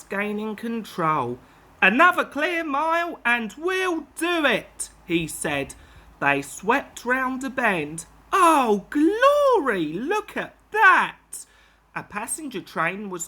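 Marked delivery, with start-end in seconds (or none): 1.8-4.9 urgent. Acted. Driver straining to stop. 8.3-11.5 shocked. Acted. Sees train ahead.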